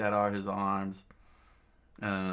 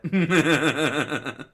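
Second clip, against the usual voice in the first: loud high burst of laughter; cracking up at the pun; real laughter; 6 semitones higher, 10 dB louder than usual. Real, not acted.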